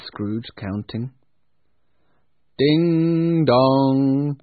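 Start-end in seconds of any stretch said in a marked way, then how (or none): 2.6-4.4 long ringing ding dong like a church bell; bell chime. A narrator's reading. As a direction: chime it out loud and slow.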